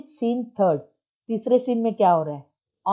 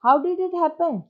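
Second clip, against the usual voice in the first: mock dismay. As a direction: feign shock, then ask. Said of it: "साधु चरण ऐसे देखा".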